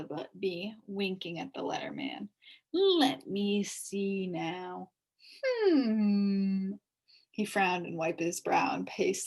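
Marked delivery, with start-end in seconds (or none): 2.7-6.7 bee pondering. A narrator's reading. Bee thinks it over.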